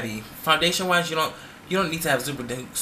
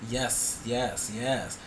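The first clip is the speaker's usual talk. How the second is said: soft mock yes; 2 semitones lower, 6 dB quieter, much slower than usual. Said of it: "you be like".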